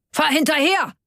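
high-pitched urgent yell; roared command. A narrator's reading. Karl orders Onno to chase the car, roaring. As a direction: yell it far above normal pitch. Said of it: "brüllte Karl sofort".

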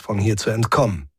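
usual speaking voice

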